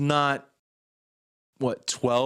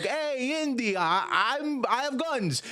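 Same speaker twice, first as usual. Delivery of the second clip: much higher cartoon gangster; mock mobster; 11 semitones higher, slower than usual. The ride's mobster taunts Indiana Jones, in a high hammed-up gangster voice. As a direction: ham up the gangster to Indy. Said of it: "it's like".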